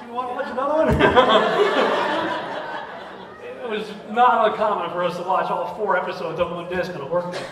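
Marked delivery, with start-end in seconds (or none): 0.1-0.9 laughing temptation, pitched up; giggling again, higher. Retold or imitated. Late night, tempting another episode. 0.9-3.6 long real laugh. Real, not acted. Laughing at their own habit.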